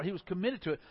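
usual speaking voice

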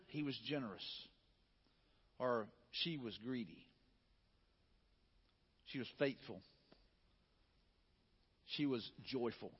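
quiet and low, as if mourners speak; soft eulogy words. Pretend. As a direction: murmur them like remembered praise. Imagined words at a funeral.

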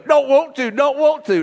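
put-on high, whiny child's refusal; scared little boy. Son at the top of the water slide refusing, high and whiny. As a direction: whine it like a frightened little kid. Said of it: "he goes".

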